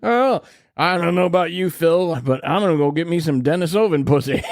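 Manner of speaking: hillbilly caveman bragging; hillbilly accent. Pretend.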